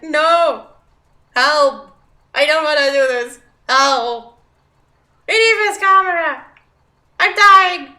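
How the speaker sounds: mock panicked screaming. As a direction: scream like you're drowning in the job, half in jest. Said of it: "And I was like".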